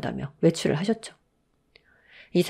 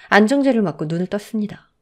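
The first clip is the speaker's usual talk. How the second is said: ordinary delivery, nothing marked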